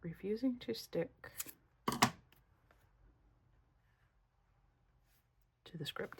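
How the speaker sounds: reading the words softly, almost to herself; Refusing to stick to the script, read quietly while placing it. Shown as a demonstration. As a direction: murmur it as she lays out the letters. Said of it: "Let's do that".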